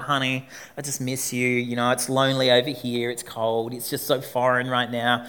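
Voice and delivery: soft, forlorn fake long-distance call; plaintive. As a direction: sound lonely and far from home, quietly. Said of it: "I sounded all forlorn".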